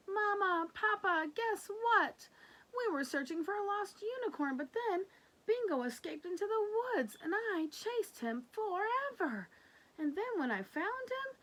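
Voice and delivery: put-on high, bubbly little girl voice; excited child. Lulu telling Mama and Papa her adventure, high and excited. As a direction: rush the story out eagerly.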